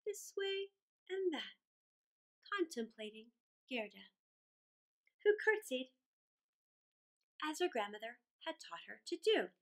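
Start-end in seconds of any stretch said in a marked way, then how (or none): none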